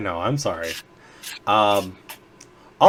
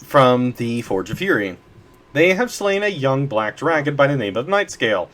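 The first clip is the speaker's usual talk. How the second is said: normal talking voice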